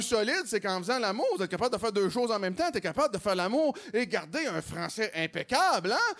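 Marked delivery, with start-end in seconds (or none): none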